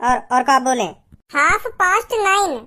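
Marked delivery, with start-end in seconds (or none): 1.3-2.6 "हाफ past nine" slowly, word by word, higher pitch; "हाफ past nine" stretched out and clearly separated; slow demonstration. Acted. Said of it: "पर मैं थोड़ा जल्दी जल्दी बोल रहा हूँ".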